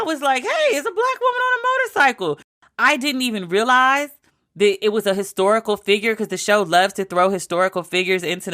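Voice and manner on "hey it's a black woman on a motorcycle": much higher, laughing in delighted surprise; excited laughing exclamation. Retold or imitated. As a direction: squeal it out, giddy at the sight.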